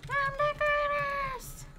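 high slow announcer voice; drawn-out game voice; voice pack voice. Retold or imitated. Imitating voice pack again.

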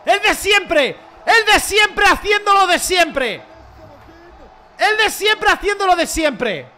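high, loud, slow triumphant chant; excited shouting. Real, not acted. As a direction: celebrate the goal at full voice. Celebrating a goal.

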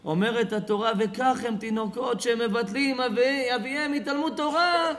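high, forceful sermon delivery; fervent preaching. Acted. Preacher warning his congregation about a child and his father, fervent preaching. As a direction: proclaim it at full force, drawing out the endings.

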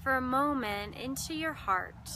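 measured meditation voice with long pauses; guided meditation. Real, not acted. Meditation host guiding listeners into breath and heart, unhurried guided meditation. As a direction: guide listeners inward, lingering on key words and leaving silences.

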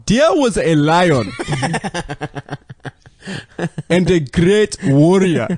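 put-on slow storyteller Scottish accent. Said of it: "That's pretty good Scottish".

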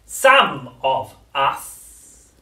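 high, very slow, coaxing crone; luring witch voice. A narrator's reading. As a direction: lure the child in slowly. Grandma before whisper.

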